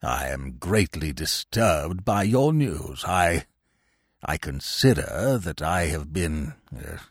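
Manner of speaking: put-on low, slow, halting old uncle, quietly overwrought; overwrought old man. A narrator's reading. Old uncle disturbed by Wooster's news, overwrought and halting. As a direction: labour out each word, low and shaken. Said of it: "in a rather overwrought way".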